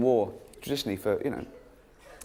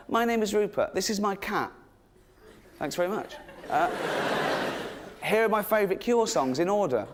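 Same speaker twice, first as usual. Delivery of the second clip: a lightly voiced, higher smug homepage owner; mock website owner; 7 semitones higher than usual. Mocking Rupert's homemade website about himself, lightly higher voice. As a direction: read it like a proud nobody with a homepage. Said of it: "I say, it's stuff like".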